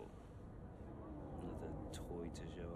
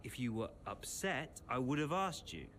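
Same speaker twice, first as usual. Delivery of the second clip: raised, defensive retort; heated defence; 6 semitones higher, 4 dB louder than usual. Acted. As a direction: voice climbing higher and louder. Quarrel, defending against accusation.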